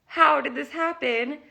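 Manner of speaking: astonished question, pitched high; retold amazement. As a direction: relive your disbelief at the news. Retells amazed reaction to good news.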